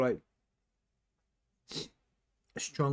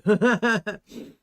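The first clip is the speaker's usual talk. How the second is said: amused laugh; 10 semitones higher, 12 dB louder than usual. Real, not acted. Laughs at own eating apology.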